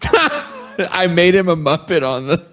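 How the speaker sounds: sheepish laughing admission. Real, not acted. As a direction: giggle it out, a bit slow. Laughs admitting the Muppet thumbnail.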